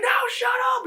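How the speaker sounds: very high frantic mock shout; panicked hushing. Girls try to silence the screaming girl, panicked.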